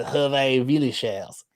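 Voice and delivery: slow, thick mock French accent; Hervé Villechaize. Shown as a demonstration. Joking about their own bad accent, slow mock French. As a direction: drag the name out in fake French. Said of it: "I start sounding like".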